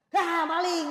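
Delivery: high, very loud cry of alarm; alarmed shout. Acted. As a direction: yell as if catching a thief. Suami istri memergoki maling. (teriak kaget)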